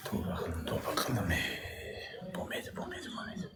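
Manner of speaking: breathless whisper to himself. Real, not acted. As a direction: whisper it under your breath. Muttered to himself.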